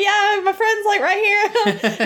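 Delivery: very high, imitated nervous excuse; uncomfortable excuse. Imitating the old lady fleeing the elevator early, uneasy. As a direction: escape politely in a high-pitched voice. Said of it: "she's not comfortable with him".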